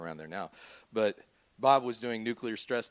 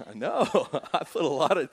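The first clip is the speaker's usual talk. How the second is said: laughing through the words; 3 semitones higher, 5 dB louder than usual. Real, not acted. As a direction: chuckle while boasting about your writing. Laughing at own boast.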